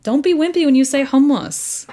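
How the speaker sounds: loud, high push through to "hummus"; gusto, not wimpy. Shown as a demonstration. Guest coaching the host on hummus, emphatic and forceful. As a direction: drive "hummus" home with real strength. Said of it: "You gotta give it that strength".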